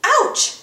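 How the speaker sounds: cartoon pain yelp; high yelp of pain. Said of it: "yelped Goofy".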